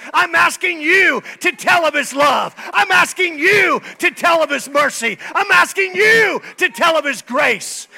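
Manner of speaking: high, loud preacher's cry pounding each line; fiery pulpit shouting; preaching at full force. Acted. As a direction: preach it hard to the crowd.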